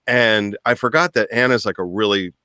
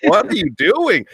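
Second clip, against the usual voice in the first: high, slow, laughing question; mock laughing disbelief; 12 semitones higher, 4 dB louder than usual. Fiancée laughing at the kneeling groom, giggling disbelief. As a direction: ask through giggles in disbelief. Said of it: "she's laughing in my face".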